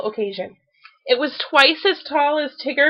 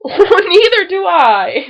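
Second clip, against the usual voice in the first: slow, loud laughing agreement; 7 semitones higher, 7 dB louder, slower than usual. A narrator's reading. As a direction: laugh along happily. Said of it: "laughed Pooh".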